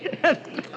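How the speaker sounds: unhinged breathy laugh. Acted. Frank after raving at the clerk.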